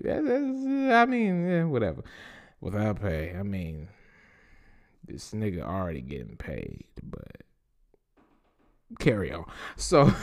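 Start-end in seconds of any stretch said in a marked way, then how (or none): 2.6-9.4 much softer, lower aside; sarcastic mutter. Real, not acted. Host scoffing to listeners, much softer and lower. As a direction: drop the voice and scoff under your breath. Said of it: "muttered sarcastic aside".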